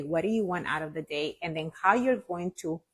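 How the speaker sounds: ordinary talk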